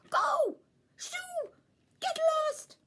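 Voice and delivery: loud, high shooing shout; furious leprechaun shouting go away. A narrator's reading. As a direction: chase the dog off with yells. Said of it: "I shouted".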